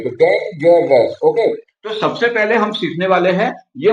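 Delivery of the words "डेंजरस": डेंजरस drawn out slowly in three parts; slow syllable split. Shown as a demonstration. Teacher repeating डेंजरस slowly in three parts. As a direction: drag out डेंजरस as डेन-ज-रस. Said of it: "वो तीन अलग अलग part में है".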